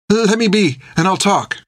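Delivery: high, frightened plea; pleading. A narrator's reading. Finn begs the captain, frightened. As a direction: beg in panic. Finn begging under the blade.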